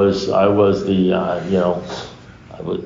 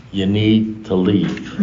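slow, evenly spaced words at usual pitch; firm, measured advice; 2 semitones lower, much slower than usual. Retold or imitated. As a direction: spell it out slowly and calmly. Acted firm advice to sponsee.

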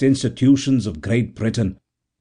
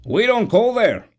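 higher, curt stevedore retort; dry retort; 11 semitones higher than usual. A narrator's reading. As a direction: shut him down dryly. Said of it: "retorted the stevedore dryly".

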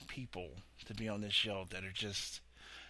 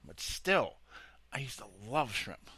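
ordinary delivery

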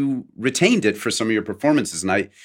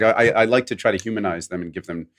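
ordinary talk, nothing marked

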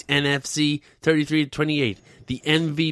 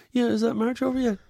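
much higher, whining; mock whiny Trump; 8 semitones higher than usual. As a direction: whine like a self-pitying president.

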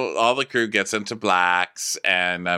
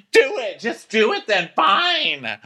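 high, loud mock outburst; hammed-up defiant comeback; acted angry dare; 14 semitones higher, 3 dB louder than usual. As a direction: snap back in a raised pitch. Acted quarrel, daring the other.

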